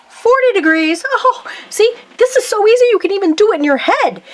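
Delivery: bright, high and quick, playfully delighted; like it's the easiest thing ever; excited. Acted. Teacher playfully answers own question.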